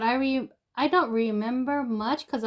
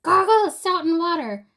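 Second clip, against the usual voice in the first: retold yell; 5 semitones higher, 4 dB louder than usual. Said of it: "when my friend yelled at me".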